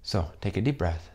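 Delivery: calm meditation voice with long pauses; guided meditation. Acted. Teacher closing the course for students, guided meditation. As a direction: lead them gently into rest, leaving space between phrases.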